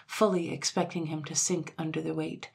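ordinary delivery

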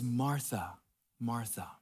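soft, gentle calling of her name; tender address. Acted. Jesus gently calling Martha by name, softly and tenderly. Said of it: "There's tenderness in that address".

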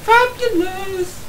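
drawn-out, high sing-song "Fabulous". Pretend. Teasing his co-player, camp fabulous drawl. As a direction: stretch "Fabulous" up high and slow. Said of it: "I'm sounding fabulous right now".

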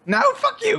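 shrieking protest; high-pitched yell. Real, not acted. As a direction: screech it in mock outrage. Being found by seeker.

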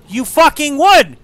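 high, loud, slow rage; shouted accusation; furious shout. Real, not acted. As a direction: roar at the game. Building rage.

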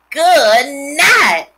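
loud, high disgusted yell; a boy yelling at a horrible smell; screaming. A narrator's reading. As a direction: shout it out at the stink. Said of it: "screamed Avery".

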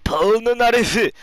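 dramatic calling out; anime shout. Retold or imitated. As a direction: call the name dramatically. Calling out character name.

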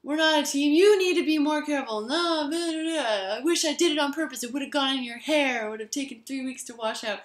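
lightly hammed-up streetcar argument; mock bickering. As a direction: act out the back-and-forth spat. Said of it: "they're saying".

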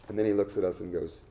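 ordinary talk, nothing marked

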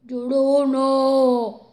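loud, slow, drawn-out ghost voice; La Llorona. Acted. Ghost tells her name in an awful voice, drawn-out wail. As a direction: drag the word out in an ugly wail. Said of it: "Tenía una puta voz que estaba".

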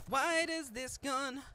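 high, slow whining complaint; whiny voice. Pretend. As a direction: whine about the gun.

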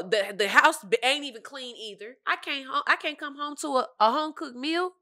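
angry husband rant. Retold or imitated. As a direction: rant like a tired working man. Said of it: "he said".

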